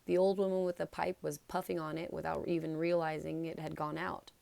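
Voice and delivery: usual speaking voice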